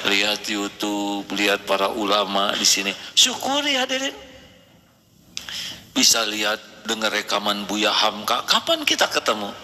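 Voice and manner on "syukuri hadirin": high, ringing call to the congregation; rally the hall to give thanks; rousing exhortation. Real, not acted. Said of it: "exhorting audience". (seruan lantang kepada hadirin)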